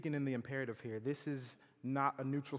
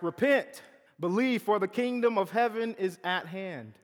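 loud, high proclaiming cry; proclaiming Jesus; 6 semitones higher, 9 dB louder than usual. Acted. Preacher voicing Jesus calling the crowd, loud and high. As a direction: call out like a prophet to the crowd.

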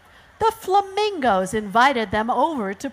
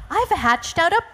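high, amazed exclamation; surprised storybook mother; astonished kangaroo; 11 semitones higher than usual. Acted. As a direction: announce it in astonishment, pitched way up. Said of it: "she said".

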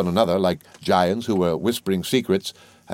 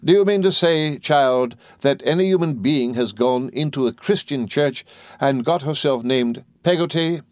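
indignant; 7 semitones higher than usual. A narrator's reading. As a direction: demand it in indignant disbelief. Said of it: "with some indignation".

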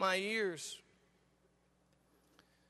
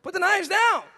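higher, louder acted parental command; exasperated order; 7 semitones higher, 8 dB louder than usual. Father ordering son to drop the knives, raised voice. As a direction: act out a dad raising his voice.

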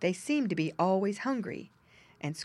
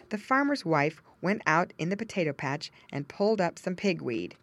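usual speaking voice

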